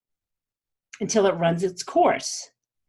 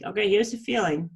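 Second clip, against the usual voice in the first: normal talking voice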